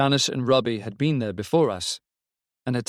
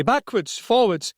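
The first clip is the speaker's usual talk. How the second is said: high, raised report down the phone; much higher, agitated voice; urgent call; 11 semitones higher, 3 dB louder than usual. A narrator's reading. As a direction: call it out in alarm. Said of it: "called Robbie".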